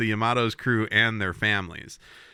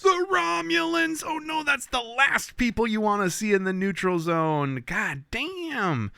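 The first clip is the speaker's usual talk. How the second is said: much higher, hammed-up cry of dread; mock alarmed shout; 12 semitones higher than usual. Podcast host hamming up the Romulans' arrival, mock alarmed shout. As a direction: sound the alarm like a cheesy villain reveal.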